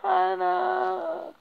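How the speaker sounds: high, strained, choked-up plea. Acted. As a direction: beg through a tight throat. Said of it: "begging her to hear my choked up voice".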